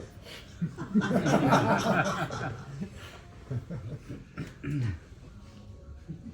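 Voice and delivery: a real laugh that rises at the end; short laugh. Real, not acted. Narrator laughing at the supergroup news.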